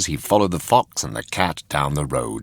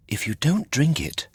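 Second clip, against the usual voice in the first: a hint of a higher, patient fairy; lightly voiced fairy; 8 semitones higher than usual. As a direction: gently warn the boy. Fairy warns gently.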